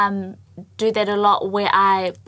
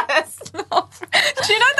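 laughing; 7 semitones higher than usual. Real, not acted. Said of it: "laughing repeat".

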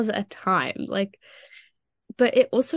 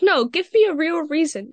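imitating the teacher, higher and louder; mock teacher; 7 semitones higher, 5 dB louder than usual. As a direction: demand a real reason in the teacher's voice. Teacher demands reason.

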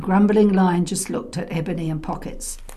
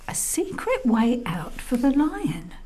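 ordinary delivery

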